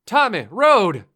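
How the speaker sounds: high, loud panicked scream; screaming warning. Acted. Friend screaming at the driver to watch the road. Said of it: "Dwayne screaming".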